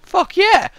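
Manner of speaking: high, loud whoop of joy; a burst of pretend triumph; mock triumphant cheer. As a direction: cheer it like you just won. Imagined reaction to happy death.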